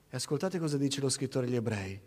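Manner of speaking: usual speaking voice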